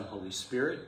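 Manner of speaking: ordinary delivery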